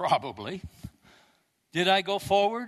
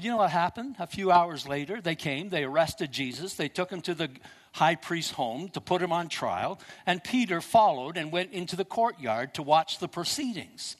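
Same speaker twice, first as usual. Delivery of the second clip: ordinary talk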